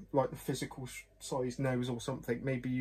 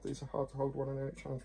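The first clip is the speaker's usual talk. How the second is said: ordinary delivery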